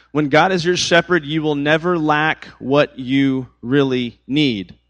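slow, measured words for note-taking; slow dictation. Shown as a demonstration. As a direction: dictate it slowly so they can write. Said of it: "I want you to write this quote down".

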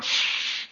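long hissed shush; stern shushing. Retold or imitated. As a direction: act out a crowd's drawn-out shh. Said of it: "sternly begin to tell him to be quiet".

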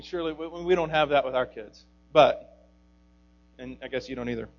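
ordinary delivery

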